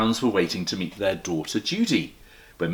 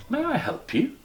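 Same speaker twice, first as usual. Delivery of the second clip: small polite bear. Acted. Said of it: "it said".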